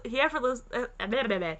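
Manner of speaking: ordinary delivery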